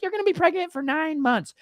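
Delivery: high, singsong false promise; mocking the liars. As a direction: parody the cheerful class instructor. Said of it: "They're like".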